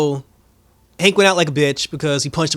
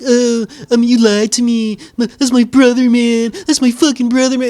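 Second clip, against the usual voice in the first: higher, louder whiny Rose; mock Rose; 7 semitones higher, 5 dB louder than usual. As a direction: voice Rose's hurt complaint, hammed up. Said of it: "Rose is just like".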